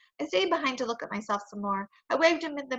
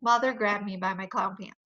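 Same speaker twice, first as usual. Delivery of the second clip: plain reading voice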